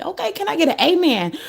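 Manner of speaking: high, fast playful call for an amen; mock preacher call. To her viewers, a playful preacher call. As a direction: toss it out like a preacher to the pews.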